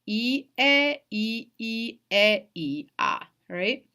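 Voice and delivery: calling out the vowels I, E, I, I, E, I, A one by one; vowels spelled out. Shown as a demonstration. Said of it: "pay attention to the vowels".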